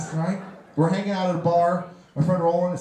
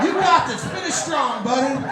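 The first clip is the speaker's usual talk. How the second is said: high, loud pep talk; a much higher, louder encouraging cheer; cheering buddy; 8 semitones higher, 4 dB louder than usual. Retold or imitated. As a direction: pump him up like a coach.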